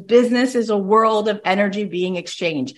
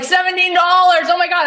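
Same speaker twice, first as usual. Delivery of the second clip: delighted shock; 11 semitones higher, 4 dB louder than usual. Retold or imitated. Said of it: "I was like".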